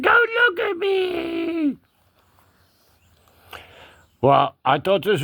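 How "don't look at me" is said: high, slow, drawn-out kitty protest; cat voice. Pretend. Cat objecting to being watched, high drawn-out cat voice. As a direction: stretch the last word up high as a cat. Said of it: "He said".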